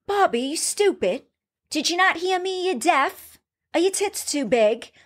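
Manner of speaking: put-on taunting the cook in an English accent; English chef insult. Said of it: "Was that your English accent".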